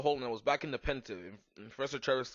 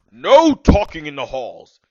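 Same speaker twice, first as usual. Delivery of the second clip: loud, slow, put-on stern shout; angry; 3 semitones higher, 20 dB louder, slower than usual. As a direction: bark it like a furious headmaster.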